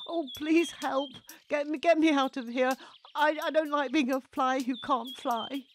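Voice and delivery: high, loud frantic plea; pleading. A narrator's reading. Freddy floating away in a bubble, panicked pleading. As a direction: beg to be rescued.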